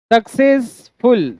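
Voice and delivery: "सक्सेसफुल" slowly split as success, ful; syllable by syllable. Shown as a demonstration. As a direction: leave a gap inside "सक्सेसफुल" before ful. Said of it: "दो syllable है". ("सक्सेसफुल" को धीरे से दो हिस्सों में बोलें)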